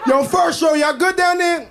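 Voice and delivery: high, loud shout down; calling down. Pretend. Top gum calling to pieces below, loud. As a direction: call down to the other gum.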